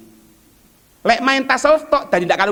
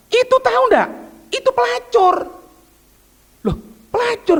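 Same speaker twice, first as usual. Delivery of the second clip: very high, raised, startled voices; shocked exchange; 13 semitones higher than usual. Retold or imitated. Friend tells the giver she was a prostitute, very high and startled. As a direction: pitch it way up in disbelief.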